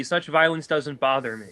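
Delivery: usual speaking voice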